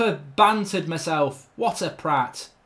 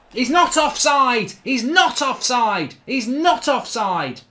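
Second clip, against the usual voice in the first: slow, emphatic insistence, each word leaned on; indignant protest; 3 semitones higher, 4 dB louder, slower than usual. Real, not acted. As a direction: dispute the referee's call, drawn out. Protesting an offside call.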